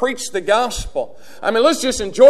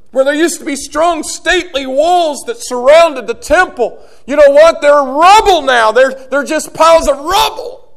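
higher, louder fiery sermon voice; full-force preaching; 6 semitones higher, 6 dB louder than usual. Acted. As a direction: preach it hard to the congregation.

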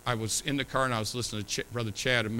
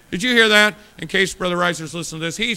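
much higher and louder teasing call; playful shout; 5 semitones higher, 11 dB louder than usual. Real, not acted. Preacher ribs a friend, loud and high. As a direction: shout it out as a joke.

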